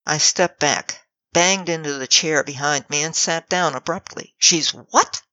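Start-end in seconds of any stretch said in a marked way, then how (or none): 4.4-5.2 squeaked. A narrator's reading. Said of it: "I squeaked".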